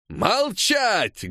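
high, forceful shout; thundering order. A narrator's reading. Cossack officer silencing strikers, thundering. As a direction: silence the crowd with one word. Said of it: "громыхнул сотник".

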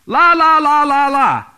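loud, high chanting la-la-la; shrieked string of la's to shut out talk; drowning-out la-la-la. Shown as a demonstration. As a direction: chant it as if blocking your ears. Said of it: "cover your ears and then five times say".

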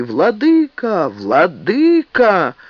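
long high calls to the bishop; calling out, drawn out. Acted. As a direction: call out slowly across a crowd. Crowd calling out.

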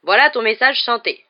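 high, loud presentation; loud announcement. A narrator's reading. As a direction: announce it to the whole corridor. Dwarf announces the singing message.

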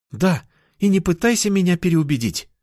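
put-on high, firm girl's voice; insistent cat girl. Girl refusing to be talked round, high and firm.

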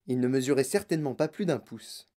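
plain reading voice

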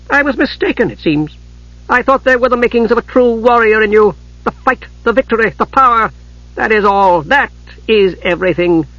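high-pitched, disdainful rebuke; scornful disappointment. A narrator's reading. Mother declaring her son no true warrior, scornful.

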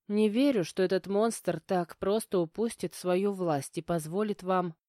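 usual speaking voice

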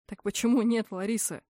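plain reading voice

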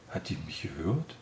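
low, surprised mumble to oneself; surprised murmur. A narrator's reading. Unseen man wondering if the patient heard him, surprised murmur. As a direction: mutter it in mild surprise. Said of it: "murmelte die Stimme überrascht".

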